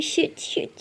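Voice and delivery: unvoiced panicked whisper; whispered curse. Lily caught faking her coma, whispered curse. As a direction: hiss the curse under your breath.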